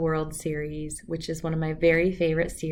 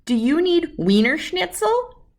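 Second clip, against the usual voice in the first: higher, louder fussing parent; anxious parent; 8 semitones higher, 6 dB louder than usual. Acted. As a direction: rattle off worried guesses. Anxious parent guesses what's wrong.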